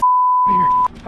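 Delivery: high, slow panicked wail. Real, not acted. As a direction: panic breaking through. Panicked in car.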